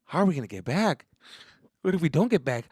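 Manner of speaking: mild, rising anxious question; worried. Retold or imitated. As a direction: ask it with creeping worry. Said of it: "getting a little fucking worried".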